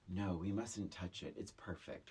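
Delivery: soft, slow, fading to a whisper; a hint of a fussy artist protecting his work; mock precious insistence. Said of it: "clearly someone said".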